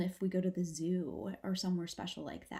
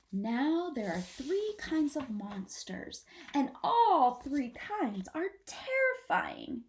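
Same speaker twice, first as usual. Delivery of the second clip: slow, louder, ominous; spooky warning; 5 semitones higher, 3 dB louder, slower than usual. Acted. Mama Possum telling babies of monsters, slow ominous. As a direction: draw it out to scare. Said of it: "make her voice sound comforting".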